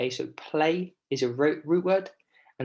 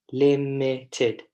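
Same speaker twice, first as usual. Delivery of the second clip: "limited" broken into three separate syllables; 3 dB louder than usual. Shown as a demonstration. Teacher shows class how to count syllables, syllable by syllable. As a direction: say "limited" slowly, beat by beat: lim-i-ted. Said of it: "tell me how many syllables".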